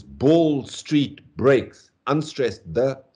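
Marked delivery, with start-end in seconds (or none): 0.0-1.9 "ball", "street", "breaks" each punched alone, higher; isolated, emphatic "ball", "street", "breaks" with gaps between; three stressed syllables. Shown as a demonstration. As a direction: hit "ball", "street", "breaks" as separate beats. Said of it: "three stressed syllables".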